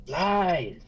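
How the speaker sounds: high, raging scream. Acted. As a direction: scream it at the flames. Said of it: "And she screams".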